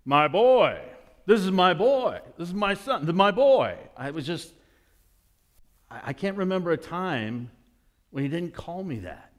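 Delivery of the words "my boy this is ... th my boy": put-on loud, higher, proudly announcing his boy; a father's public pride, retold. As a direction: show off your son to the room. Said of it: "He'd say it in front of anybody".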